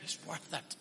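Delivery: breathy whisper of awe. Real, not acted. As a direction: drop to a bare whisper. Whispered after climax.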